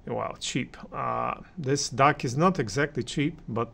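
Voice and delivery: normal talking voice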